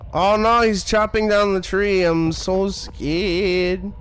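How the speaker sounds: hammed-up fake fright, long drawn-out last word; sarcastic, badly faked terror; mock fear. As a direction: pretend to be scared, wailing slowly. Mocking the horror game's scares.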